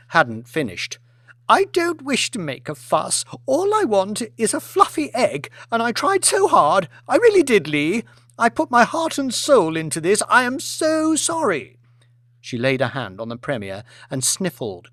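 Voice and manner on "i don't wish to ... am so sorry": high, tearful wail of wounded pride; a melodramatic victim milking sympathy; self-pitying lament. Acted. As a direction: aristocrat playing the wronged martyr to the table. Lady performing self-pity.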